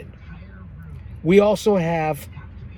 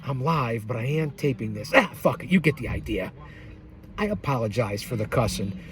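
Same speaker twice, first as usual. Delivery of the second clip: lower, much softer self-correction; muttered aside; 6 semitones lower, 7 dB quieter than usual. Real, not acted. Host quietly correcting his own catchphrase. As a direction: mumble it to yourself.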